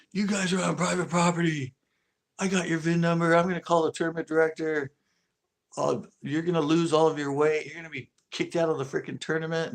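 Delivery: imitating the worker yelling at them; angry yell. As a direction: bark the threats at them. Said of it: "he was yelling that at us".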